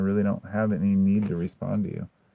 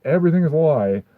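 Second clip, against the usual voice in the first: louder, higher mock ranting believer; mock conspiracy theorist; 5 semitones higher, 7 dB louder than usual. Streamer parodying commenters who call the news fake, hammed-up rant. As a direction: ham up their paranoid rant. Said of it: "you're just like".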